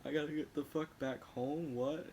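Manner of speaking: high, giggly retold outburst; mock giggly panic. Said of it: "And I was laughing. I was like".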